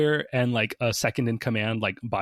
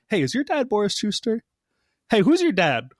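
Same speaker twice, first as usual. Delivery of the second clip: high, eager mock interrogation; excitedly nosy, pitched up; playful pestering; 9 semitones higher than usual. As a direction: accost strangers with a nosy question. Playfully pestering characters about their dad.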